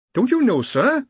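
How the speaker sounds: high-pitched, affronted surprise; taken aback. A narrator's reading. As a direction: answer the boss as if thrown by his question. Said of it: "taken aback".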